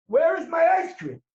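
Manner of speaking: frustrated. Acted. Frustrated shopper.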